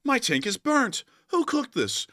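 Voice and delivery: high mock complaint; lightly voiced diner; complaining customer. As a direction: whine about the burnt order. Voicing a customer.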